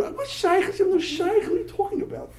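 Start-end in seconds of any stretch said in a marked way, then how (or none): 0.0-0.7 high-pitched, incredulous retort; mock incredulity. Rabbi acting out a reply to a silly question, high and incredulous. Acted exchange about the question. 0.9-2.3 high-pitched dismissive retort; mock incredulity. Rabbi dismissing the lady's question, high and incredulous. Acted exchange about the question.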